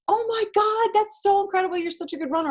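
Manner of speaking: gushing praise. Retold or imitated. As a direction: imitate friends raving over her time. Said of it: "everyone was like".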